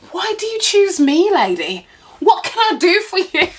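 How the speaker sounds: high-pitched mock exasperation; hammed-up, helpless complaint; exasperated appeal. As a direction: plead in pretend bafflement, pitch jumping up. Acted complaint to the old lady.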